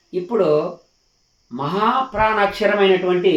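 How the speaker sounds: ordinary talk, nothing marked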